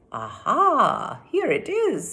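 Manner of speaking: high, bright aha; witch's triumphant find. Acted. The witch finds the golden ring in a drawer, delighted. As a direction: crow over the find. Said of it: "said Stregonona".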